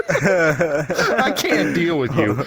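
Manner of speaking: slow overwhelmed laughing. Retold or imitated. Stranger overwhelmed by his moves, laughing slowly.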